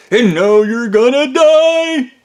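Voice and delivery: slow, loud, high taunt ending in an evil cackle; evil villain. Retold or imitated. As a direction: gloat like a cartoon villain. Villain forcing poisoned coffee.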